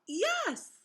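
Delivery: high excited yes; cried out. Acted. Cat confirming Sally's guess, high and excited. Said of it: "The cat cried out".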